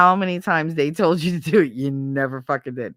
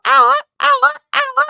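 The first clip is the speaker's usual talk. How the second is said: very high, loud squawked screeches; rapid-fire screech in place of a whistle; repeated screech; 9 dB louder than usual. Shown as a demonstration. Storyteller showing her window signal, piercing high repeated screech. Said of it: "there was a sound on there".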